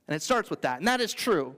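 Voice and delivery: normal talking voice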